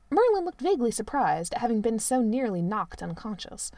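usual speaking voice

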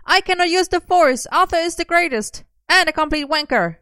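high-pitched, loud yell; shouted. A narrator's reading. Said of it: "as Merlin shouted".

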